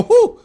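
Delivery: loud, very high whoop; mocking "whoo". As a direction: exaggerate a jumpy onlooker's squeal. Mocks friend's jumpy reaction.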